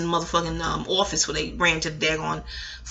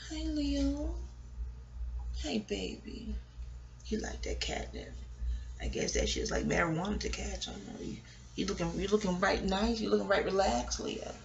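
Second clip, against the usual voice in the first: much softer pet talk; cooing to the cat; 14 dB quieter than usual. Real, not acted. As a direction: murmur sweetly to a relaxed cat. Talking softly to the cat.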